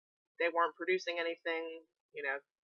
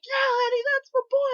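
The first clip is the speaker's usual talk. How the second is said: high, loud mock fan cheer; imitating a fan shouting; 11 dB louder than usual. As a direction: holler it like a proud fan. Fan behind cheering for Leddy.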